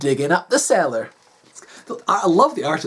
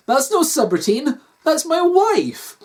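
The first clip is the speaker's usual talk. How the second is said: higher, stretched final words; mild outrage, drawing out the end; indignant defence; 9 semitones higher, slower than usual. Acted. Husband defending his wife to the doctor, indignant.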